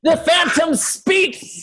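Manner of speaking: high, slow theatrical cry; dramatic announcer. Pretend. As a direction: proclaim it like a ham actor.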